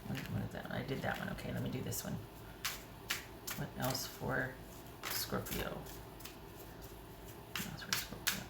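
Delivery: very quiet, half-whispered mumble; absent-minded murmur while searching; muttering to oneself. Real, not acted. Muttering to herself while picking cards.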